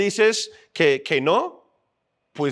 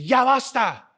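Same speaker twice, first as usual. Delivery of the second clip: imitating his mother, higher pitched and stern; scolding mom; 6 semitones higher, 3 dB louder than usual. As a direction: play the fed-up mom ordering the boys to stop. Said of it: "Y mi mamá llega y dice".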